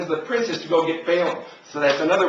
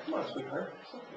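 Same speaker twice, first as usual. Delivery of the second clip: much softer, gentle reassurance; soft aside; 15 dB quieter, faster than usual. Real, not acted. Preacher soothing a child mid-sermon, softly. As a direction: murmur comfort to the little one.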